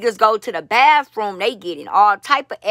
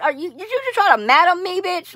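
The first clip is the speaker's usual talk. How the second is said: indignant disbelief; 9 semitones higher than usual. Real, not acted.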